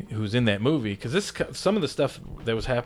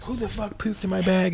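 much higher, mock indignant outburst; outraged; 10 semitones higher than usual. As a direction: imitate an indignant wrestler, pitched way up. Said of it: "he's like".

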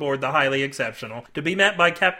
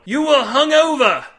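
high, loud indignant shout; exasperated roar; 10 semitones higher, 9 dB louder than usual. A narrator's reading. Cade to his deluded mates, roaring. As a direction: hammer home the obvious.

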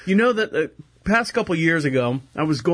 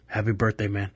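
much lower, softer big-guy voice; huge guy's voice; 10 semitones lower, 5 dB quieter than usual. Retold or imitated. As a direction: drop deep and soft for the manager. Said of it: "And he's like, um".

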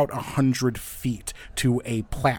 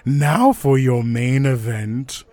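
booming, drawn-out arena announcer; big showman build-up, loud and high; loudspeaker announcer; 3 semitones higher, 7 dB louder than usual. Acted. Game master introduces three challengers to the arena, booming announcer voice. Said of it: "you hear a voice over a loudspeaker".

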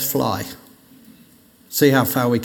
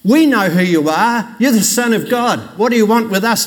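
higher and louder, a mock shout of the demons; retold demon shout; 7 semitones higher, 5 dB louder than usual. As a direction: cry out like demons who recognise him. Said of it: "demons who would shout".

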